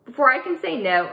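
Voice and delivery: ordinary delivery, nothing marked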